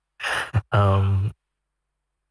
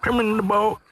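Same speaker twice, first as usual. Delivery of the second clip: pitched-up, hammed Southern American accent; mock Southern American accent; 11 semitones higher than usual. Streamer failing to copy the cook, in a mock Southern American accent. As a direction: squeak out the cook's line in a Southern American accent. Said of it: "It's too hard".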